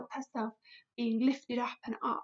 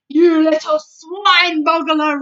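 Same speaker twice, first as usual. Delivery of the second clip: loud slow furious insult; roaring; 4 semitones higher, 16 dB louder, slower than usual. A narrator's reading. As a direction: roar it at the giant. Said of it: "roared the blood bottler".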